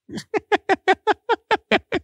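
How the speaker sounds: high-pitched giggle after a scream; high laugh. Real, not acted. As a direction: laugh it off, squeaky. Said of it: "headphone user warning".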